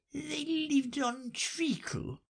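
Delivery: a tiny high Dormouse, partly whispered. A narrator's reading. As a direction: squeak the story out like a tiny mouse. Dormouse answers.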